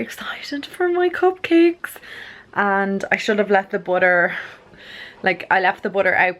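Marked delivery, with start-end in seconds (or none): 0.0-2.0 high, breathy excited whisper. Real, not acted. Excited about her cupcakes.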